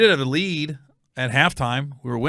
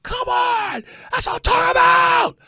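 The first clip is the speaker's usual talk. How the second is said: high, loud excited yell; 6 dB louder than usual. Retold or imitated. Fan yelling at the TV in his hotel room, high and loud. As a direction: scream it out at the TV. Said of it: "I'm yelling".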